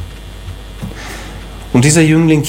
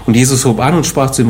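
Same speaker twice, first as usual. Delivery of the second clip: ordinary talk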